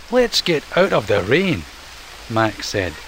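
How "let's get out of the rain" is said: higher, louder eager raccoon voice; raccoon Mac. Acted. Mac to Ian, eager higher raccoon voice. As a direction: Mac urging his friend out of the rain.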